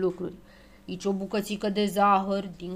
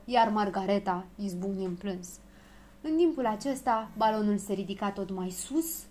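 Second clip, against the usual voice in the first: ordinary delivery, nothing marked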